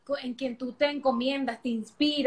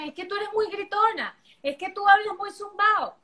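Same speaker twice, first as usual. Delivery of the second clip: quoting critics; 7 semitones higher, 4 dB louder than usual. Retold or imitated. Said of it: "a veces me dicen".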